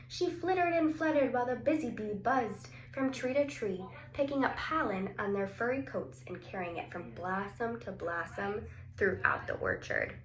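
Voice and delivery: plain reading voice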